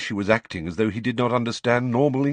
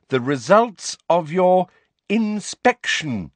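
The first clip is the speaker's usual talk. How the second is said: drawn-out, louder and higher; loud, slow voice; 10 semitones higher, 5 dB louder, slower than usual. A narrator's reading. Umbridge talking down to Hagrid, loud and slow. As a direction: speak slowly as if to a child. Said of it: "that loud, slow voice".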